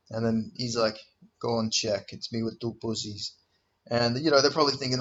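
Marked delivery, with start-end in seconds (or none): 1.4-3.3 low, quiet, deadpan delivery of the punchline; deadpan joke voice. Retold or imitated. Fighter deadpans his photo joke to friends, quiet and dry. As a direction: say it dry and soft, like a straight-faced joke.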